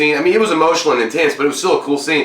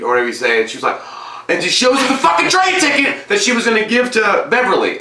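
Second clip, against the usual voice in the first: normal talking voice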